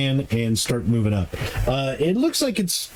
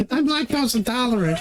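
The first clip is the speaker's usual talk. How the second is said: put-on whiny kid voice; 10 semitones higher than usual.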